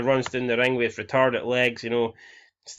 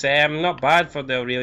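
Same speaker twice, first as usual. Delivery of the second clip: normal talking voice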